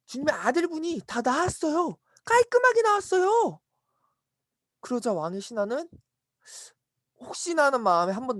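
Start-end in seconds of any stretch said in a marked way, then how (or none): 0.1-3.6 high-pitched jubilant announcement; excited good news. Acted. Servants tell master his son is healed, high excited cry. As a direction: build to a high, gleeful cry.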